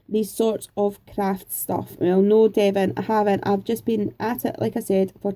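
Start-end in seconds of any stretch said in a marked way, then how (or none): none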